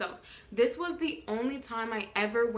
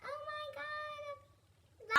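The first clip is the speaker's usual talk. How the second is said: high, slow, mock-frightened "Oh my God"; mock scared gasp; 8 dB quieter, much slower than usual. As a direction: pretend to be a startled little girl. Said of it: "I'm not scary ass little girl".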